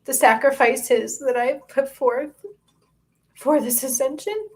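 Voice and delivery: fighting back tears as the pitch climbs; getting emotional. Real, not acted. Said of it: "I'm getting emotional".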